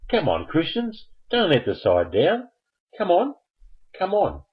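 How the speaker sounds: high-pitched egging-on of the team; playful cheerleading plea; rallying. Real, not acted. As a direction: urge them on, pitch jumping high.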